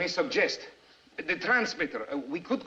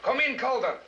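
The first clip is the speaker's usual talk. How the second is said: calling out; 9 semitones higher than usual. Acted. Radio operator calling unanswered contact.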